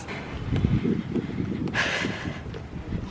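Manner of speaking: long winded breath out; heavy exhale. Real, not acted. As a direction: blow out air after exertion. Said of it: "Sorry, I'm out of breath".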